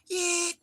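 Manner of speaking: very high, loud squeal; sudden piercing whoop, played for laughs; mock outburst yell. Odd kid while everyone looks on, mock squeal.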